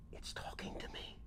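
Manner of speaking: hushed whisper. Acted. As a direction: whisper it so nobody hears. Hushed to coworker.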